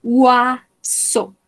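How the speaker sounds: Uaso said as wa-so, eau as O; Uaso, el eau como una O; oiseau sounds. Shown as a demonstration. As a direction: model Uaso slowly, wa then so. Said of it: "se pronuncian como una O".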